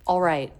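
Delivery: "All right" as "aw-right", L left out; dropped L. Shown as a demonstration. Teacher showing you can get away with dropping the L. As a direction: drop the L of "All". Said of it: "dropping the L".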